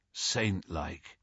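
injured son murmuring; murmured. Acted. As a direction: mumble it dazed. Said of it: "he murmured".

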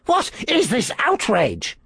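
high, slow outraged priest; indignant priest. A narrator's reading. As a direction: demand it with offended pride.

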